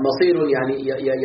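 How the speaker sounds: ordinary talk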